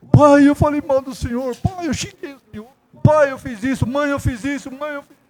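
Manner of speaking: put-on high, soft pleading child voice; a child's tearful plea to its parents; children begging forgiveness. Children's tearful confession, retold. (criança pedindo perdão, voz alta e suplicante)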